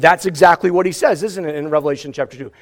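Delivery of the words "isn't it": normal talking voice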